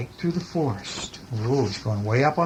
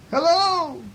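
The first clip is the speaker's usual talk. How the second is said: very high echoed hello; moon's echo; 3 dB louder than usual. A narrator's reading. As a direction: echo the call back, pitched way up. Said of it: "replied the moon".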